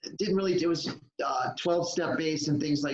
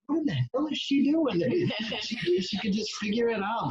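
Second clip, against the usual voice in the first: baffled surprise; 8 semitones higher than usual. Retold or imitated. His baffled reaction retold.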